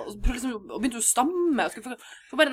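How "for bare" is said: ordinary talk, nothing marked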